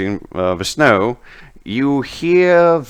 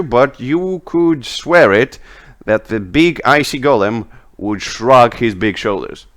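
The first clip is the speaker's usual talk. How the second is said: usual speaking voice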